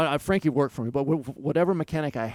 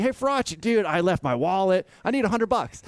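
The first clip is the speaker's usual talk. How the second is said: high-pitched, louder put-on plea; hammed-up ask for a favour, pitched up; mock pleading request; 7 semitones higher, 4 dB louder than usual. Rider hitting up team boss for cash, mock pleading. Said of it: "I'd do the same thing".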